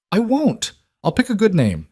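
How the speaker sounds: put-on high, eager little-girl promise; high-pitched eager child pleading. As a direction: gush it out like an excited kid.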